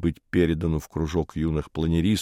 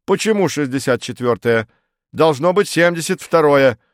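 loud, insistent challenge rising in pitch; objecting official; 6 semitones higher, 5 dB louder than usual. A narrator's reading. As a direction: dispute it like a fussy committee man. Farfurkis challenges the case number. (придирчиво, напористо)

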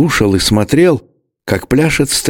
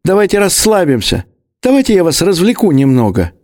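much higher, fussing placating voice; high old man's voice; 10 semitones higher than usual. A narrator's reading. Old man calms the two quarrelers, high fussing voice. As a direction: soothe them in a high old voice.

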